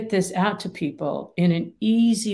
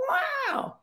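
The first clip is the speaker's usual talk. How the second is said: a sudden much higher, startled wow; mock alarmed wow; 5 dB quieter than usual. The amygdala reacting to being told to calm down, high and startled. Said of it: "All that does to the amygdala is go".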